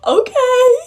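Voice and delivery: high, loud squealed acceptance; mock delighted squeal. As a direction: imitate a thrilled little sister. Sister delighted by gift, acted.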